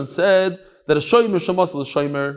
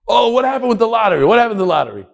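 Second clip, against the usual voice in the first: eager question; 8 semitones higher, 6 dB louder, faster than usual. Retold or imitated. Boys asking after lottery results.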